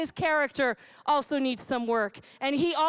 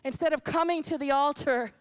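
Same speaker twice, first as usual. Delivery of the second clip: normal talking voice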